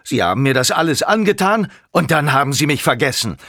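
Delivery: high, loud reproach; wounded, heated accusing outburst; angry accusation. Acted. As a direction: hurl it as a bitter reproach. Villain's accusing outburst in story.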